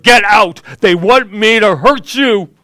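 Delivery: all-out frantic warning shout; father screaming his son out of the house; desperate scream. A narrator's reading. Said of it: "His voice got louder, urgent, and somewhat desperate".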